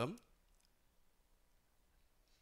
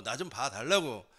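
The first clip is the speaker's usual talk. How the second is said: urgent plea building in pitch and volume; acted escalating cry for attention; shouting louder; 4 semitones higher, 5 dB louder than usual. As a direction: beg to be heard, more forceful each phrase. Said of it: "더 크게 소리 지른단 말이에요".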